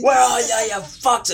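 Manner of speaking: high, very loud demand; furious mock yell. Driver hunting hidden kids, imitated furious yelling. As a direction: holler for the hidden culprits.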